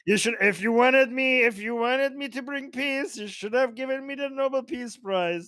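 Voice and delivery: high, loud barbarian warlord boast; Conan the Barbarian. Retold or imitated. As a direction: bellow it like a pompous warlord. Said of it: "now he sounds like Conan the Barbarian".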